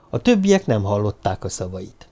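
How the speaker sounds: usual speaking voice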